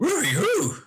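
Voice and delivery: Scooby-Doo turning Adam Sandler. Pretend. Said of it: "from Scooby to Sandler".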